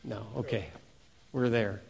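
ordinary delivery

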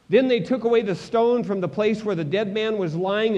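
ordinary delivery

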